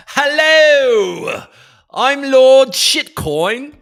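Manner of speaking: high, loud, hammed-up British lord; grand mock-British proclamation, drawn out; British accent. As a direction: announce yourself as a pompous British lord. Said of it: "As a British citizen in a prior life".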